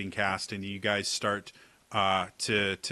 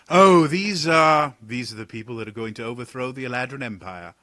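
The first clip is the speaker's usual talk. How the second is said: high, loud, theatrical exasperated sigh; a wry noble teasing the jumpy guards; Talon's voice; 4 semitones higher, 10 dB louder than usual. Acted. Talon scolds the guards for their glaives, mock weary.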